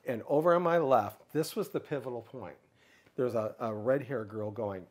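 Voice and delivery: ordinary delivery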